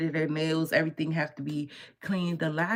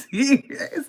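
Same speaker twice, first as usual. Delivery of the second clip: high, loud mock laughing fit; laughing squeal, put on; crying with laughter; 10 semitones higher, 6 dB louder than usual. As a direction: imitate someone screaming with laughter. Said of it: "He be screaming".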